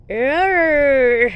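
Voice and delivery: loud put-on roar; playful dinosaur roar. Mother roars like a dinosaur for her son.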